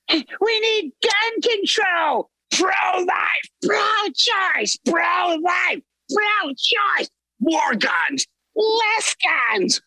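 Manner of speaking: very high squealing outrage; high crying mock hysteria; shrill outrage. Mocking TV outrage channel flipping.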